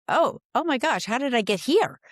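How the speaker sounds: high-pitched dismayed gasp of realisation; retold shock, voice jumping up; startled realisation. Guest recalls seeing her age in the newspaper, startled.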